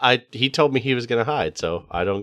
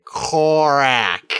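higher, drawn-out bad Draconic accent; terrible Draconic accent; 6 semitones higher, 4 dB louder than usual. Introducing the kobold in Common, terrible Draconic accent. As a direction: mangle it in a made-up Draconic accent. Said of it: "with a really terrible, uh, like, accent".

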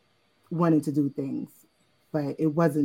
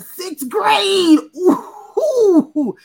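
high laughing cry of disbelief; exasperated squeal; 14 semitones higher, 10 dB louder, much slower than usual. Real, not acted. Mother recalling wild sixth grade, high squealing disbelief. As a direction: shriek it like you can't believe it.

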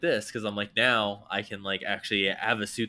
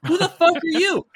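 loud, high-pitched incredulous challenge; indignant outburst; 9 dB louder than usual. Retold or imitated. Homeowner challenging a kid at his door, indignant shout. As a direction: shout it in outraged disbelief. Retold confrontation with intruding kid.

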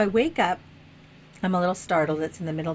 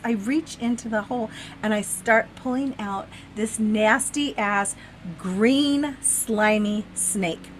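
ordinary delivery

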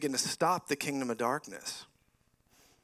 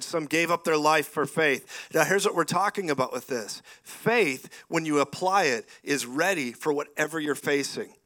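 usual speaking voice

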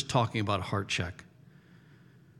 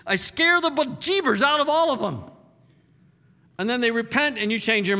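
Jonah's outburst. Acted. Jonah confessing to God, voiced.

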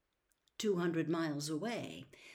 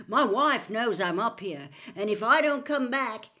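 loud, defiant character voice; defiant bluff; 4 semitones higher, 9 dB louder than usual. A narrator's reading. Frank bluffing the cold voice, loud and defiant. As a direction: bluff loudly to warn him off. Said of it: "on a sudden inspiration".

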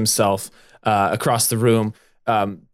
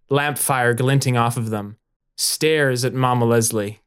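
usual speaking voice

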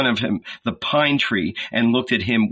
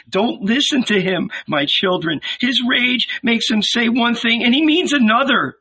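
put-on pleading old woman; 11 semitones higher than usual. A narrator's reading. Salome pleading with the crowd.

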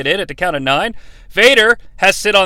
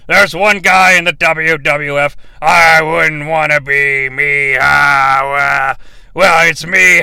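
put-on rambling drunken slur, lower voice; drunk wrestler; 4 semitones lower than usual. Heartbreak Kid boasting about two monsters, drunken slur. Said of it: "He's under the influence".

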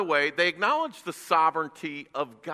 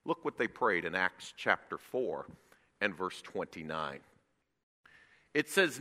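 ordinary talk, nothing marked

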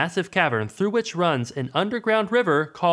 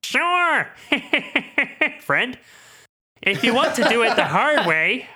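high, chirpy neighborly laugh; cheery Flanders-like laugh; Ned Flanders voice; 9 semitones higher, slower than usual. Acted. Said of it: "That's who you sound like. Ned Flanders".

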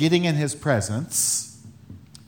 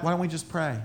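much softer, gentle suggestion; calm suggestion; 7 dB quieter, faster than usual. Retold or imitated. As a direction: calmly offer the answer. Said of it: "And you're like".

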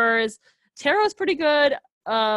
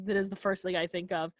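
ordinary delivery

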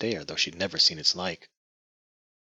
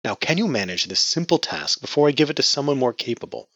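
contemptuous; 8 semitones higher, 5 dB louder than usual. Acted. Patriarch sneers at daughter.

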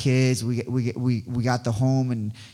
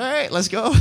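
excited; 9 semitones higher than usual. Retold or imitated. Eager reply to building school.

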